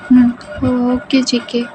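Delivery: high, drawn-out agreement; meek girlfriend, reassured; comforted girlfriend. Acted. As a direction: agree slowly in a small high voice. Girlfriend agrees in higher pitch.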